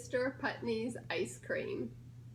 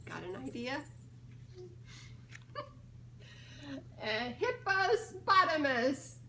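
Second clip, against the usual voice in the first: ordinary delivery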